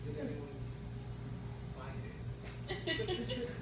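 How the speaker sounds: very soft, tentative acted question; hushed inquiry. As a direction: ask very quietly, as if confiding it. Acts himself asking at lost and found.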